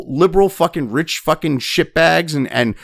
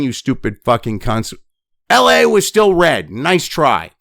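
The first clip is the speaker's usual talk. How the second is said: ordinary talk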